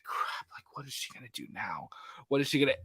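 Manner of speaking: hushed, worried whisper; a whispered moment of worry; panicked whisper. Retold or imitated. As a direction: whisper it like you're scared in the dark. Voicing the audience's panic.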